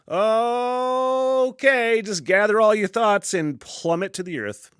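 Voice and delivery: high drawn-out "Okay", then talk yourself through it; mock acceptance mid-fall. Imagined calm acceptance while falling.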